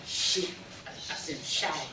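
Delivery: "she" said with a sh sound as in shy; pronunciation demo. Shown as a demonstration. Teacher shows students the diacritic S, clear demo. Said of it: "right here we say".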